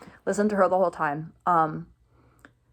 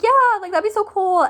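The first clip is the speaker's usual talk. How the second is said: much higher and louder, gushing fan; starstruck squeal; 12 semitones higher, 5 dB louder than usual. Retold or imitated. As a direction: squeal it like a fan who can't believe it. Her own starstruck reply retold.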